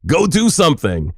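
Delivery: high, hammed-up grandpa rousing someone; imitating grandpa's wake-up call. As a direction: mimic a grandpa nagging a sleeper awake. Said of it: "he'd call me early in the morning".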